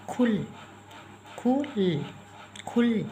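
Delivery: खुल with a brief u; soft short u. Shown as a demonstration. Teacher showing the small u sound, soft short u. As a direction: keep the vowel in खुल short. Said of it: "softly वह sound कर रहा है".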